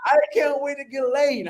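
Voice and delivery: high, slow, drawn-out gushing; imitated eager mom. Mom telling her son about Hawaii, mock eager. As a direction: mimic mom announcing it with relish.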